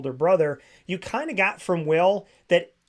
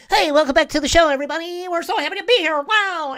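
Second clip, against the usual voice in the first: very high, loud, gushing host; ridiculous radio voice; 14 semitones higher, 9 dB louder, faster than usual. Retold or imitated. Mocking a host turning the mic up, ridiculous radio voice. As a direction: blast a hammed-up show opening at the audience. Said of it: "he turned the mic up and he was like".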